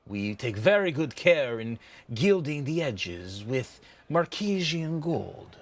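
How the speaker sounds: shopkeeper savoring the words, drawn out in places; boastful trader's showy pitch; proud merchant. Acted. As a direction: sell it grandly, with swells and drops. Game master voices merchant.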